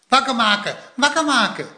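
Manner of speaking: put-on high, chirpy child's echo; excited little brother. A narrator's reading. Little brother Poekie echoing Plop's plan, excited child voice.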